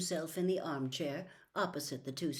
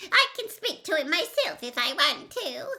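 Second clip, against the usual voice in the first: squeaky Wormtail voice; 9 semitones higher, 8 dB louder than usual. A narrator's reading.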